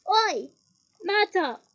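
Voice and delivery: loud shout across the hall; calling out. Acted. Peeves calls loudly to Myrtle across the feast. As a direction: yell out to summon her.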